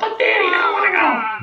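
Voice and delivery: very high, squealed mock child voice; whiny little son. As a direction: whine in falsetto like a reluctant kid. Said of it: "you be the son".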